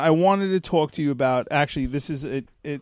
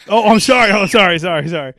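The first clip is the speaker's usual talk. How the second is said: apologizing too much; 4 semitones higher, 7 dB louder than usual. Real, not acted. Blurted out a kid's name.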